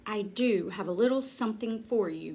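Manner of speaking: ordinary delivery, nothing marked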